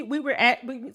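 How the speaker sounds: normal talking voice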